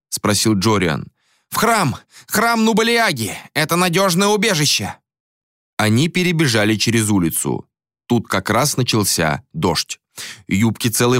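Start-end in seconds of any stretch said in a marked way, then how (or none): 1.5-4.9 urgent call. A narrator's reading. Old man urging flight.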